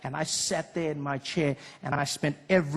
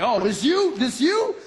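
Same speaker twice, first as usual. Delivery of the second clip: rising higher each time, playful and louder; cool American greeting; 6 semitones higher, 5 dB louder, slower than usual. Retold or imitated. Comic imitating a cool guy complimenting you. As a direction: hype up a friend with a grin. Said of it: "It was like".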